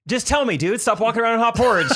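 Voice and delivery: high, loud comic demand; mock impatience. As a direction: blurt it out impatiently. Acts out impatient use of idiom.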